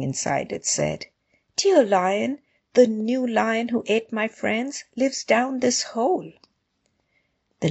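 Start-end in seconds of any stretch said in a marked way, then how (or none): 1.6-6.3 lightly voiced rabbit, mostly higher in pitch; a hint of an anxious little rabbit; meek rabbit. A narrator's reading. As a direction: explain humbly in a high storybook voice.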